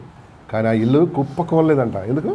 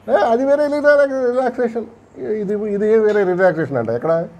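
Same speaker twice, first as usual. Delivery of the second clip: high-pitched imitated objection, easing down; mock protest; 7 semitones higher, slower than usual. Arguing over the friends' farmhouse relaxation, mock protest. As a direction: act out an indignant comeback in a raised pitch.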